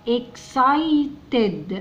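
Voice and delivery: "excited" slowly, in separated, stretched syllables; broken into syllables. Shown as a demonstration. Teacher sounding out "excited" for children, slow syllables.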